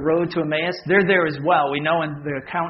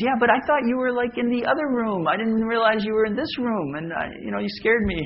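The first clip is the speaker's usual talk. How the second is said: higher, lightly put-on wife voice; imitating wife; 9 semitones higher than usual. Wife explaining to her husband that he scared her, imitated higher. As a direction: play the flustered wife, pitched up. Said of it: "And, uh, she goes".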